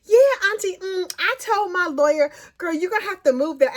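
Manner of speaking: breezy, unbothered impression of Corey; mocking Corey on the phone. Corey chatting to her auntie on jail phone, mocked. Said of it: "And she was like".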